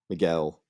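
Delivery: Miguel with the weight on -guel; Miguel, mi-GUEL, second beat stressed; stress on second syllable. Shown as a demonstration. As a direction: land on the last syllable of Miguel. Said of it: "the emphasis lands on the second syllable".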